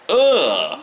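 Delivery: a loud, high "Ugh!" of revulsion; the kid groans after Lemmy shuts the door: loud high disgusted groan. Retold or imitated. Loud mock disgust groan.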